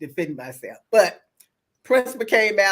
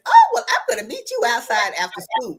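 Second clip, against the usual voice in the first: imitating a bully, raised pitch and volume; retold challenge; 4 semitones higher, 5 dB louder than usual. Bully calling her out in the lunchroom, mock challenge. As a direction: act out the bully's taunting challenge.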